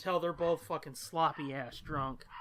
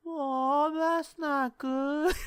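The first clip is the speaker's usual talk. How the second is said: higher, slow, dazed; dopey drunk boyfriend; 7 semitones higher, 3 dB louder, much slower than usual. Retold or imitated. Drunk boyfriend reacting to girlfriend's ripped dress. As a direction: say it like a sloppy drunk.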